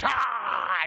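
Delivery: sudden high loud monster cry; beast yell. Acted. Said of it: "I can't do a good beast yell".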